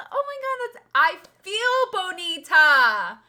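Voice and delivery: loud, slow playful gush; excited. Real, not acted. Telling a viewer about the weekend, loud and gleeful. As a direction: savour the word with delight.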